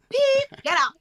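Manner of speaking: shrieking teacher cracking high on get out; mock teacher squealing get out; squeaky peak. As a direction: voice jumps way up on peep. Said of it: "teacher's voice cracks to a squeak".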